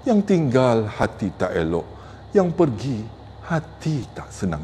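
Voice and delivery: gentle, hushed close of a pantun; gentle recitation. Shown as a demonstration. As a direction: let the verse sink softly. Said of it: "memang cukup berlembut".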